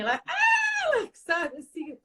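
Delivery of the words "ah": high-pitched mock toddler shriek; imitated child's scream. Mother showing how her daughter screams when told no, mock shriek. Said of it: "ela tá dando uns gritos aqui".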